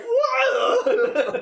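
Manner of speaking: very high, loud, laughing What; laughing confused dog. Retold or imitated. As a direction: squeak it out while cracking up. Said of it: "He was like".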